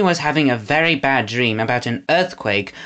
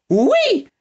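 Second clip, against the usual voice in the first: much higher, prissy put-on voice; frou-frou voice. A narrator's reading. Snatcher mocks Eggs in a prissy frou-frou voice. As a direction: flounce it, all airs. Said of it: "in his frou-frou voice".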